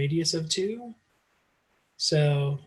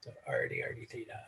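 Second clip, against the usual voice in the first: pirate voice; 12 dB quieter, much faster than usual. Pretend. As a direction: say it like a gruff pirate, arrr. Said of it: "We always gotta talk like a pirate".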